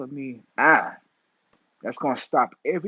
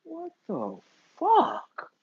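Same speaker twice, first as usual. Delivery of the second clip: stretched disbelief; much slower than usual. Real, not acted. Reacting in disbelief.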